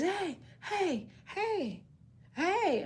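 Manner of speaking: hint of someone searching outside; imitating someone calling. Family calling from outside garage.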